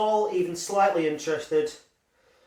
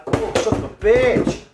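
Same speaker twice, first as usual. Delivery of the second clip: loud, high cry of frustration; real exasperated outburst; 6 semitones higher, 8 dB louder than usual. Real, not acted. Reviewer cursing while showing the Scotch pie, exasperated shout. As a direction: curse out loud at a sudden mishap.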